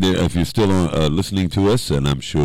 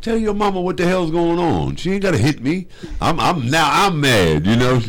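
retold exasperation; 10 semitones higher than usual. Retelling his own complaint.

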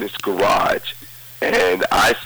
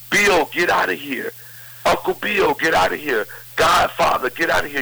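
retold inmates shouting; 7 semitones higher than usual.

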